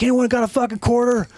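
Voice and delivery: high-pitched mock appeal to a room; exasperated calling out. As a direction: call out for help, hammed-up. Acted desperate plea to whole room.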